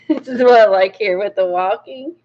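ordinary talk, nothing marked

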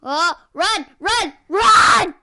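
building to a loud yell; urgent shouting. Real, not acted. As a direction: urge them to run. Urging to flee.

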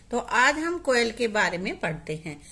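ordinary talk

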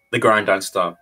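ordinary talk, nothing marked

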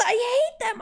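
high loud I hate them; furious high shout; angry shout. Real, not acted. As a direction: shout it in frustration. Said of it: "I'm actually upset now".

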